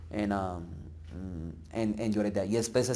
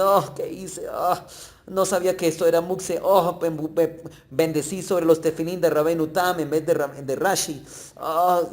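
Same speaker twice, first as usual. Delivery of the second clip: higher, pained "oh" laments; mock lament; 4 semitones higher than usual. A tzadik mourns his mistake in a hammed-up, higher lament. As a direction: wail over a small slip, hammed-up.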